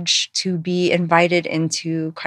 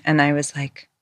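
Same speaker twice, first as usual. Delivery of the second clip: usual speaking voice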